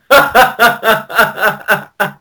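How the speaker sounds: long high-pitched giggle; high laugh. Real, not acted. Co-host giggling at his own Brad Pitt joke. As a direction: let a high laugh roll out.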